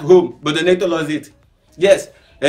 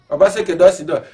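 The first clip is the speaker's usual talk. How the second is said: ordinary talk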